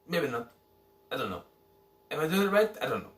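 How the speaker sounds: ordinary delivery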